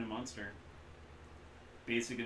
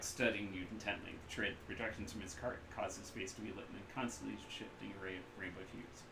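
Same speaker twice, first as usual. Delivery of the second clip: normal talking voice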